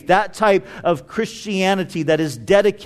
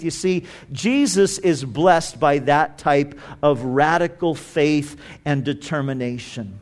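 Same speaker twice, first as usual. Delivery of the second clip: normal talking voice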